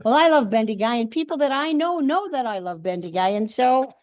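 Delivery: usual speaking voice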